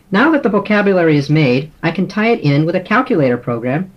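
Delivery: ordinary delivery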